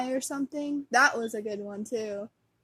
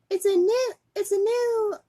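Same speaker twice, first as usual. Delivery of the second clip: high, louder put-on voice, close to cracking up; acted commercial kid; 10 semitones higher, 5 dB louder than usual. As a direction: play a kid in an ad, fighting laughter. Acting out cereal commercial, cracks up.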